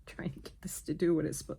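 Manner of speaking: whispered while holding breath. Real, not acted. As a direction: squeeze the words out on held breath. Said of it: "holding my breath".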